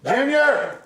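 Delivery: loud call to the dog. Real, not acted. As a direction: yell the dog's name across the house. Calling the dog.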